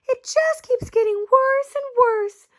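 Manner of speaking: high, despairing mother; distressed. A narrator's reading. Mother despairing over Camila, high and tearful. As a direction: plead with rising despair. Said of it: "cried Missus Cream".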